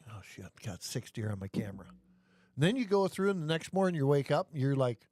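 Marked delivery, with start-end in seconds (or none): 0.1-1.9 much lower and softer, as if to oneself; hushed self-talk. Retold or imitated. Imitating someone checking the camera in bed at night, hushed. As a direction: murmur it low like a private thought.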